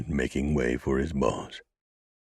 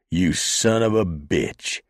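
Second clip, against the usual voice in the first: bellowing; 5 semitones higher, 5 dB louder, slower than usual. A narrator's reading. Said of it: "he bellowed".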